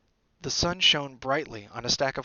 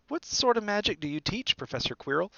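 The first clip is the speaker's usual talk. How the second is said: put-on higher, boyish question; young Harry; 8 semitones higher than usual. A narrator's reading. Harry asking his future teacher, higher boyish voice. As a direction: ask with innocent curiosity.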